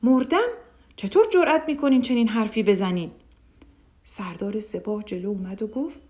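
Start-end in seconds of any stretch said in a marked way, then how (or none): 0.0-3.1 shocked, loud ruler's outburst; a ruler who just heard he is dead, shouting; startled shout. A narrator's reading. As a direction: bark it out in outrage. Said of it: "خیلی جا خورده بود فریاد زد".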